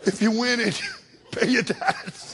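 soft, laughing, slow; laughing aside. Real, not acted. Preacher laughing at his own lottery slip. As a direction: chuckle the joke out. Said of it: "Did I say lottery?".

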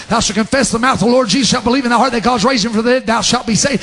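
verse rattled off at speed; rapid recitation. Real, not acted. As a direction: race through the scripture without pausing. Rapid second reading of verse.

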